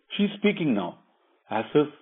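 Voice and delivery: ordinary delivery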